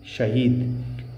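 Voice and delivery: शहीद stretched slowly; slow careful word. Shown as a demonstration. Teacher slowly pronounces शहीद for the class. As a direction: draw out शहीद syllable by syllable. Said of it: "तो जो शहीद होते हैं".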